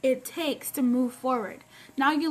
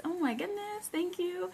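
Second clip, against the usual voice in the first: ordinary talk, nothing marked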